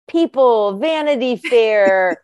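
high, loud incredulous rant; playfully outraged at the idea; excited. Real, not acted. Mocking vacation reading, incredulous.

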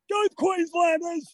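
rally the home crowd; rallying cheer. Real, not acted. Runner cheering on listeners back home, slow and high.

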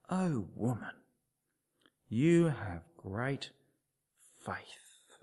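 hushed, slow unvoiced words of praise; soft whisper. Jesus to the woman, hushed and slow. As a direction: murmur it like a tender blessing.

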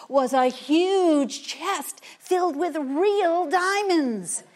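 slow, loud, high wonder; grand reveal. A narrator's reading. Narrator unveiling the chest of diamonds to children, slow and amazed. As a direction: announce the treasure with awe.

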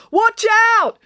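high, loud warning shout; warning yell. A narrator's reading. Joe warns the delivery man, loud yell. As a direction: yell to warn him off. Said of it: "Joe yelled in warning".

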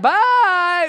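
high, loud, stretched-out yell; comically panicked farewell shout; fleeing shout. Acted. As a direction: holler goodbye as you run off. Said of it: "you know what I would have said?".

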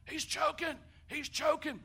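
high, loud panicked call for help; a bystander's high panicked cry; alarmed shout. Retold or imitated. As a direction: yell it out in alarm as if someone is choking. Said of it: "somebody said".

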